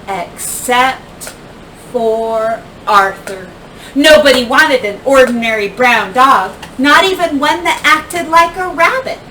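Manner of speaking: usual speaking voice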